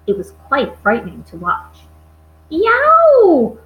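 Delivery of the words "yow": loud, high-pitched giant's yowl; roar of pain. A narrator's reading. Sleeping giant roars in a nightmare, loud high yell. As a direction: howl it out high and long. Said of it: "roared the fresh flesh lump eater".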